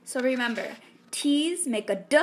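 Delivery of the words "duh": "D" as a short voiced "duh"; D sound. Shown as a demonstration. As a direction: say "D" as the consonant sound, not the letter name. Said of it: "a D sound".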